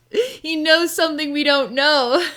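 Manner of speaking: high, loud, bursting with laughter; laughing while talking. Real, not acted. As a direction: let the laugh break through the words. Bursts out laughing mid-sentence.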